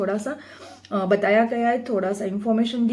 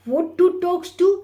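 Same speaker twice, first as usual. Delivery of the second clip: slow question; 7 semitones higher, 3 dB louder, much slower than usual. Shown as a demonstration. Teacher reads question aloud slowly.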